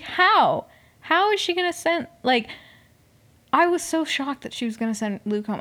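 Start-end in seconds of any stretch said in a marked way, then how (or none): 0.0-2.1 high, loud-starting burst of shock; floored disbelief. Retold or imitated. Viewer reliving her shock at who gets sent home, incredulous. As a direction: retell it as stunned, high-pitched disbelief. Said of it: "I was fucking floored".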